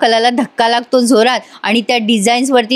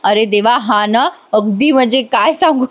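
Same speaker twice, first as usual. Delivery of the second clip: ordinary delivery, nothing marked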